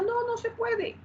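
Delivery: very high, soft voice; pitched far up, quietly; high soft answer. Shown as a demonstration. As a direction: answer your own question high and lightly. Teacher answers own rhetorical question.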